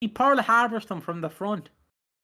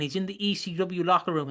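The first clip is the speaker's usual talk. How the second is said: ordinary delivery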